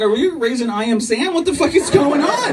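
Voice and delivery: a dad hamming up shock, voice shooting up; mock alarm. Dad reacting to toddler son, hammed-up shocked squeal. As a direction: pitch climbing high into laughing disbelief.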